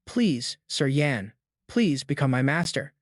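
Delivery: usual speaking voice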